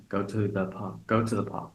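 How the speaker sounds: "to the" said light and reduced in "go to the park"; weak function words. Shown as a demonstration. Teacher demos unstressed little words for learners. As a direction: swallow "to the" in "Go to the park". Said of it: "not really pronounced very strongly".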